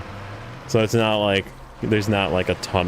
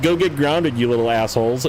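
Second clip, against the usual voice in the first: mock taunt; 5 semitones higher than usual. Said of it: "And then I'm like".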